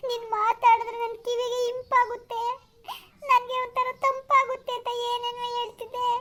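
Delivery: acted weeping complaint; tearful. Girlfriend accusing Arun of missing another woman, crying. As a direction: sob out the jealous accusation. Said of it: "please ಅಳ್ಬೇಡ ಆಯ್ತಾ?".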